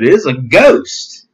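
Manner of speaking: slow, drawn-out frightened cry; mock fearful cry. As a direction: voice the disciples' fright. Disciples cry out in fear.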